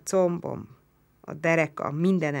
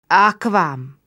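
louder, higher groaned answer; groaned back; 4 semitones higher, 9 dB louder than usual. A narrator's reading. Roman groaning back a plea for water. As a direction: groan the word back at him. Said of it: "nyögte vissza".

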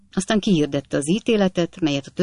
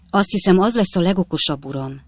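plain reading voice

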